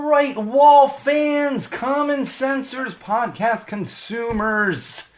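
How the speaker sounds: booming, stretched-out show announcer. Acted. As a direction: announce it like a big radio host, high and slow. Podcast host's big show opening.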